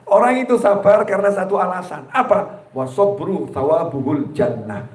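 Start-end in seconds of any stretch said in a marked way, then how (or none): 2.7-4.9 slow, lower recitation; recited Arabic. Acted. Preacher recites the Arabic saying slowly. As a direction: intone the Arabic like scripture.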